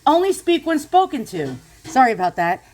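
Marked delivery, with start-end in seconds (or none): 0.1-1.6 high-pitched bossy shushing; testy reprimand, rising higher; cranky scolding. Real, not acted. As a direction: hush the interrupter irritably. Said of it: "I'm sort of cranky".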